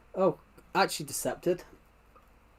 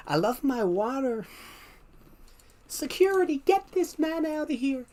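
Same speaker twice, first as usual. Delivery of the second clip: mock squeaky character; 12 semitones higher than usual. Mock scene about water and security.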